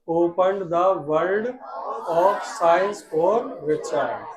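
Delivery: very slow, each word spaced out; slow dictation. Shown as a demonstration. Teacher dictating slowly for students to repeat. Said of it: "साथ में बोला करो बेटा".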